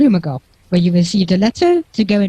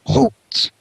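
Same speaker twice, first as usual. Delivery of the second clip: higher-pitched gruff orc call; 6 semitones higher than usual. Acted. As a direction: bark a halt as the gruff guard. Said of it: "you hear this gruff voice saying".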